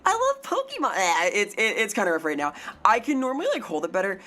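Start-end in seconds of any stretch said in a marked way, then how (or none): 0.0-0.9 very high, bright cutesy greeting; high-pitched kawaii VTuber voice; squeaky kawaii voice. Shown as a demonstration. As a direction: chirp it out like an excited anime girl. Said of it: "It wasn't so bad".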